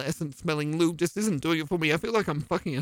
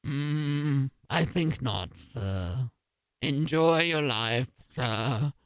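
disdainful low butler; 8 semitones lower, 4 dB quieter than usual. Acted.

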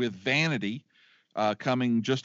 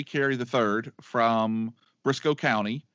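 ordinary delivery

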